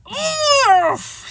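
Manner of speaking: high, drawn-out "oof"; strained yelp of impact; muffled groan. A narrator's reading. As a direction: groan loudly after landing face first. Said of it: "he said, or something to that effect".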